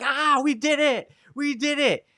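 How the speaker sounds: high, giddy victory shout; excited young interns celebrating; gleeful cheer. Retold or imitated. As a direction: cheer like kids who just won big. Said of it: "laughing and dancing and joking".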